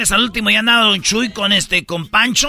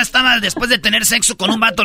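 ordinary talk

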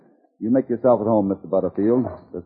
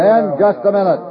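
raised, high, louder command; commanding call; 11 semitones higher, 5 dB louder than usual. Acted. Cassidy halts the miners before gunplay, commanding call. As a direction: call the group to a halt. Halts a restless armed group.

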